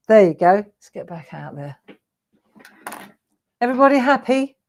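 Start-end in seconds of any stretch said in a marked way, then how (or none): none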